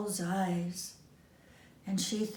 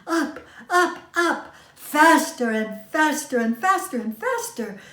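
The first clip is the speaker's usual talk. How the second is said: building excitement; 6 semitones higher, 6 dB louder than usual. A narrator's reading. Train speeding up the mountain.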